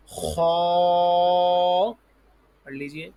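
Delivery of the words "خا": خا with a rasped throat kh and full, long vowel; heavy kha. Shown as a demonstration. Teacher models the letter for pupils, heavy drawn-out خا. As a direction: puff the mouth and hold خا long and loud. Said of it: "اس طرح آواز نکالیں گے".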